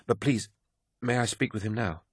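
high, soft plea; pleading softly. A narrator's reading. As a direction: beg gently to be let in. She pleads to see him.

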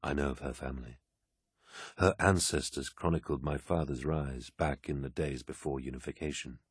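low, soft voice of authority; musing warlord. A narrator's reading. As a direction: recall it quietly, almost to yourself. Narrator voices Horus musing.

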